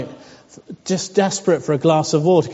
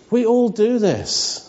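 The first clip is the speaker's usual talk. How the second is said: ordinary talk, nothing marked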